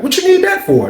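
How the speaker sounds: high, startled question; alarmed. Retold or imitated. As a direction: caught off guard by the request. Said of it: "That's what I wanted to say".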